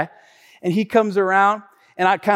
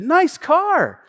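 higher-pitched friendly compliment, imitated; acting out an innocent compliment; sincere compliment; 9 semitones higher than usual. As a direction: say "Nice car" as if truly meant. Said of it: "I don't think he said it like that".

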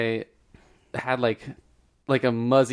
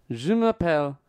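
mock French-accented Muzzy; lightly voiced French cartoon monster; 3 semitones higher than usual. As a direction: say it like a kids' French tape. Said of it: "those Muzzy ads".

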